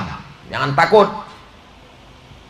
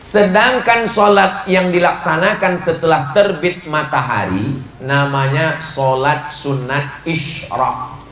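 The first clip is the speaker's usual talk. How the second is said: ordinary talk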